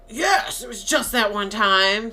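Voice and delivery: defensive. Retold or imitated. Mort answering his ex-wife, defensive. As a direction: imitate a defensive husband snapping back. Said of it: "he gets very defensive".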